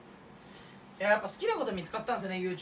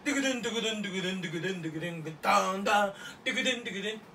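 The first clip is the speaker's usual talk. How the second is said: drum beatbox; much faster than usual. Acted. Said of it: "ドラムじゃないだろ".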